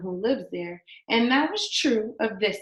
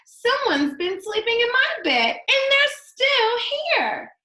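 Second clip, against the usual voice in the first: much higher, louder tiny bear voice, slowing at the end; baby bear crying out; 13 semitones higher, 5 dB louder than usual. Acted. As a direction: squeal it as the littlest bear. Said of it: "he cried".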